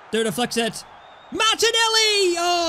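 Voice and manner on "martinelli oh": high, loud excited call of the name. Real, not acted. Gamer calling his teammate's run, excited high call. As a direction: call the name as the chance opens.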